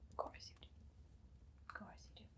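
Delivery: unvoiced whisper to oneself; barely-there whisper; under the breath. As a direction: whisper it very softly, almost inaudibly. Reassures herself under her breath.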